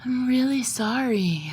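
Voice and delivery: high, slow, overdone fluster; flustered apology. Acted. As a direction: play the fluster up, apologizing slowly. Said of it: "I say in my most flustered voice".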